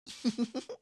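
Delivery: short, pleased little laugh; creepy laugh. Acted. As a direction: laugh at your own creepy gift. Marcy after her creepy reveal.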